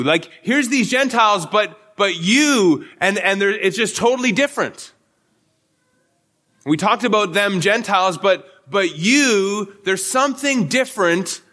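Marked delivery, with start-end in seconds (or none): 1.4-2.9 "you" slowed and leaned on; stress on you. Shown as a demonstration. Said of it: "a huge emphasis here on, on you". 8.2-9.8 slow, with the weight on "you"; stress on you. Shown as a demonstration. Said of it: "a huge emphasis here on, on you".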